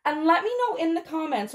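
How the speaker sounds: ordinary delivery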